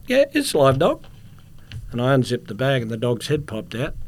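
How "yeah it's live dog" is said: high, louder mock broken-English answer; echoing the guard. Traveller confirming to guard, high mimicking answer. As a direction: confirm it back in his pitched-up style. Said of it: "I said".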